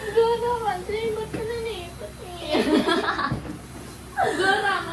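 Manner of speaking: tearful, half laughing through tears; choked up, laughing and crying at once; after crying. Real, not acted.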